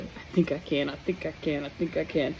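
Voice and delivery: quiet chugging little engine chant. Retold or imitated. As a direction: murmur it like a small determined train. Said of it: "speak like the little engine that could".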